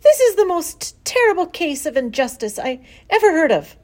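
high, loud, impassioned complaint; outraged protest. A narrator's reading. Daughter accuses father of terrible injustice, outraged. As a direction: declare it with childlike outrage.

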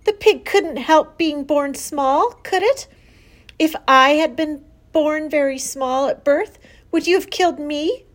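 high, loud, pleading argument; crying out. A narrator's reading. Daughter challenges father over the weakling, crying out. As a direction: press the protest with upset urgency. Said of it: "cried Fern".

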